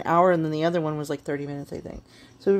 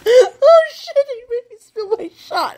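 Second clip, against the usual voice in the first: high loud startled cry; startled yelp; 8 dB louder, slower than usual. Real, not acted. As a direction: yelp as the drink spills. Shot spilled mid-laugh.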